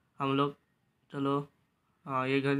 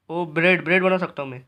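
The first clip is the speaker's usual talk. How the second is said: excited realisation; 2 semitones higher, 7 dB louder than usual. Real, not acted. Realises he can make bread.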